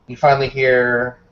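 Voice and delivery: usual speaking voice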